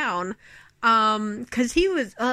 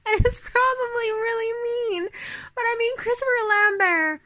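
laughing; 9 semitones higher than usual. Real, not acted. Laughing after mocking the movie.

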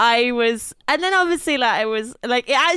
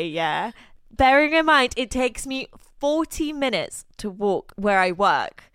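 ordinary talk, nothing marked

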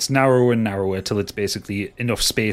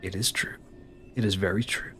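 a villain softly confirming the legend; soft confirmation; 2 semitones lower, 8 dB quieter than usual. Acted. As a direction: say it softly, savouring it.

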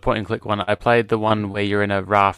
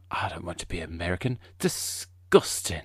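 snooty British; 3 semitones lower, 7 dB quieter than usual. Retold or imitated. Snobby British actor sneering at Americans, posh and disdainful. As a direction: look down your nose at Americans. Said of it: "top of the cream type British person".

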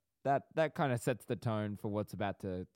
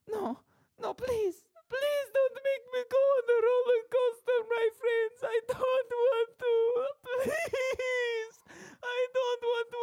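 panicked squeal, extremely high and getting louder; Mort from Madagascar; 6 dB louder than usual. Acted. Scared rider begging friends while being strapped in, panicked squeal. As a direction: beg desperately in a tiny creature's wailing voice. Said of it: "started doing Mort from Madagascar".

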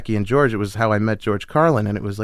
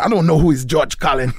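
put-on Jamaican accent; 4 semitones higher than usual. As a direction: imitate a Jamaican security guard. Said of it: "the Jamaican security guards".

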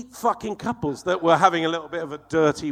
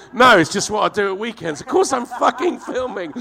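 sarcastic retort; 7 semitones higher, 6 dB louder than usual. Retold or imitated.

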